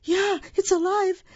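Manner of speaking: very high-pitched shrieking; panicked scream. A narrator's reading. Lady finding the doll is alive, panicked scream. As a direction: shriek it high in comic horror. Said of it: "The lady screamed".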